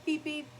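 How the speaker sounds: high beep beep; high imitated beeping; imitating beep. Shown as a demonstration. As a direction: mimic the collar tone.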